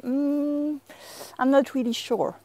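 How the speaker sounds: long high doubtful hum, then hesitant words; unsure. Shown as a demonstration. French teacher shows what mouais means, doubtful and unsure. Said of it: "it's a matter of tone of voice".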